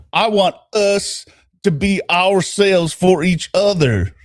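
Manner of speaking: put-on measured, earnest Southern accent. Boyfriend begs her to love him, Southern accent. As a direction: plead lightly in a Southern accent, slowing at the end. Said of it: "version of his accent".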